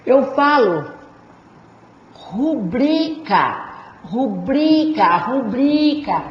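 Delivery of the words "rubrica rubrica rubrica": "rubrica" slowly, BRI drawn out; stress on BRI. Shown as a demonstration. Teacher drilling the tonic syllable, long BRI in "rubrica". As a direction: stretch the middle syllable of "rubrica". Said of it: "Sílaba tônica é o bri".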